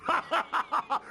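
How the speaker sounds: mocking laugh. Front de Bœuf mocks the siege.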